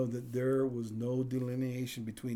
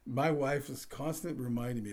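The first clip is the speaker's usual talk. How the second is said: ordinary delivery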